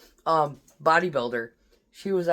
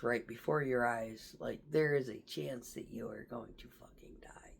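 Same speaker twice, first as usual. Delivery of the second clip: sinking into a near-whisper; hushed; 6 semitones lower, 11 dB quieter than usual. Real, not acted. Veteran explaining facing death, in a grave hush. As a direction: let the voice drop away almost to nothing.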